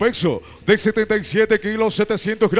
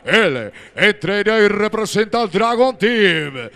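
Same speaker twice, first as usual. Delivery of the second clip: ordinary talk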